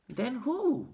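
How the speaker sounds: put-on high, eager boy's question; boy's urgent little cry. A narrator's reading. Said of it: "cried Charlie".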